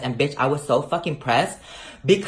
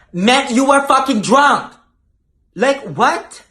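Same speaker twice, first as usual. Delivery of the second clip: loud and high in disbelief; outraged outburst; 9 semitones higher, 7 dB louder, slower than usual. Real, not acted. She rants to viewers about the cop, loud and high. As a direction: shoot the pitch up, indignant.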